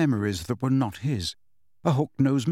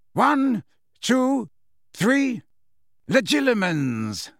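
counting up to a spell; 10 semitones higher, much slower than usual. A narrator's reading. Snape casts spell.

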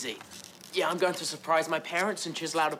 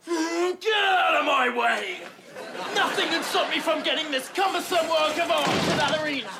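high, frantic yelling; frantic shout; 8 semitones higher, 4 dB louder than usual. Acted. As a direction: shout in a panicked rush. Rushing to stop rival.

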